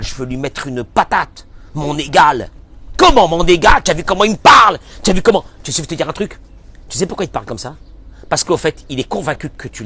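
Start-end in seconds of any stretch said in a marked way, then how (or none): 3.0-5.4 louder, outraged parent's retort; indignant parent. Acted. A father complaining to the rabbi about his teenage son, indignant and louder. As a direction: protest like an offended father.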